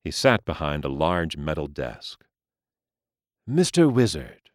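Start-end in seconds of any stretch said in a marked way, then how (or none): 3.5-4.5 aging gentleman voice. Acted. Mister Lee greets.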